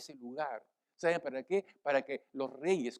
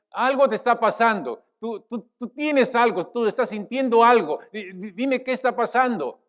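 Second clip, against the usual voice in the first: raised, louder voice, pressing for an answer; insistent questioning king; 7 semitones higher, 9 dB louder than usual. Acted. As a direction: press a troubled servant to explain, high and urgent. Kings insistently questioning their servant. (rey que interroga con insistencia)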